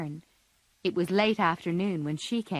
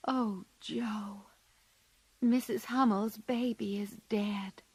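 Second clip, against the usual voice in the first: hushed; 2 semitones higher, 5 dB quieter than usual. A narrator's reading. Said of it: "said Beth".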